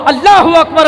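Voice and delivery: usual speaking voice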